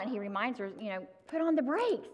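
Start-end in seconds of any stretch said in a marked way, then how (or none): 1.3-2.1 retold scream. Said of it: "he screams out".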